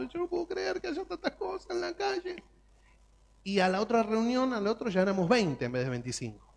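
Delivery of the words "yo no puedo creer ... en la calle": pitched way up and soft, voice near tears; tearful. Retold or imitated. Church member moved by street poverty, softly tearful. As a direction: let the words catch as if crying.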